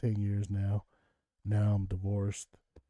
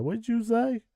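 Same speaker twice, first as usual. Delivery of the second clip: puzzled clerk; 12 semitones higher than usual. Retold or imitated. Store clerk puzzled by accent.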